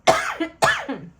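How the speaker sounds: sharp cough from a drink down the wrong way; choking cough. Real, not acted. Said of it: "Now I'm choking on it".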